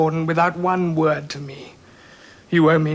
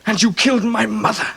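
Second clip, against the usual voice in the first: normal talking voice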